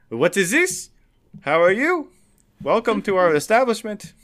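high, loud fake French accent. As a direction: greet guests in a hammed-up French accent. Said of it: "In a really fake French accent".

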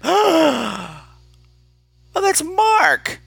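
high, thrilled exclamation; astonished recognition. Retold or imitated. Theatergoer realizing she knows the lead, astonished. Retold recognition of actor friend.

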